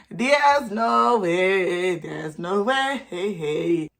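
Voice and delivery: very slow, stretched "no way"; drawn-out disbelief. Retold or imitated. Reacting to students cheating, slow exaggerated disbelief. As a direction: drag every word out in mock disbelief. Same words repeated, hugely drawn out.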